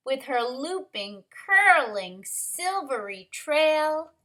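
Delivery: lively rhyming picture-book reading, lifted stresses; story narration. A narrator's reading. Teacher reads rhyming story to kindergarteners, lively narration. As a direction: punch the key word of each phrase.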